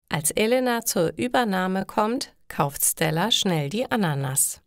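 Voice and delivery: plain reading voice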